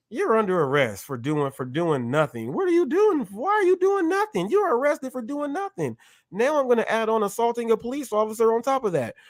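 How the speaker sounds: high mocking cop voice, fast at the end; mock cop arrest. Playing the cop, mockingly arresting the woman. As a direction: sneer through the arrest.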